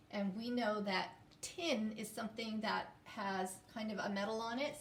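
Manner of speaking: ordinary talk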